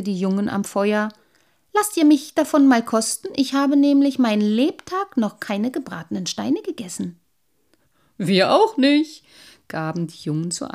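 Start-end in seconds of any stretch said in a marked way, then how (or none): 1.7-7.2 higher, louder, curious sprite voice; a small magical creature politely asking for a taste; little water sprite begging the boys for a bite, eagerly. A narrator's reading. 8.2-9.2 higher, louder and slow; a plain boyish reply, drawn out; boys answering. A narrator's reading.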